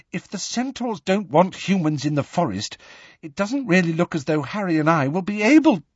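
high, hurried, breathless worry. A narrator's reading. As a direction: reason anxiously while out of breath. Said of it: "said Hermione breathlessly".

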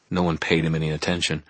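usual speaking voice

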